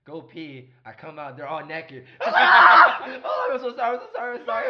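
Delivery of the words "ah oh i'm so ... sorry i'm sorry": high shriek into loud, frantic apologies; panicked apology. Retold or imitated. Guy walking in on naked models, loud high panicked apology. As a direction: reenact a startled, hammed-up panic. Walks in on naked women, retold.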